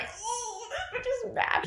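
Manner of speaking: very high, soft squeal of a voice; mock awed reaction. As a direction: coo it high, half laughing. Acting out reaction to ripped arm.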